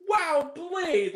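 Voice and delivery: imitating a kid's high-pitched wonder; awed little fan. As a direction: play a little boy who is so into it. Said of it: "he was so into it".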